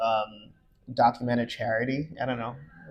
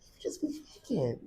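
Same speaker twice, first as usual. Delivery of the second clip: whispered, softer jab; whispered aside teasing her about New York; 9 semitones higher, 12 dB quieter than usual. Real, not acted. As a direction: lean in and whisper the tease.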